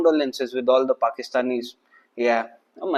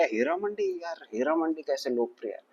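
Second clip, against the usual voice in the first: imitating a puzzled viewer; 3 semitones higher, 10 dB quieter than usual. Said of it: "साहब, आप बोले".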